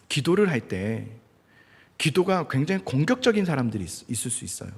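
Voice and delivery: ordinary delivery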